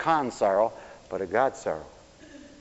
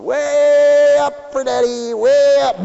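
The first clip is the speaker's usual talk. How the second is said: put-on high, loud sing-song call to a baby; playful baby calling; 12 semitones higher, 10 dB louder, slower than usual. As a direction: lift the baby and call up to her. Father lifting baby up high.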